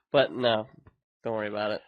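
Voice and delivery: ordinary delivery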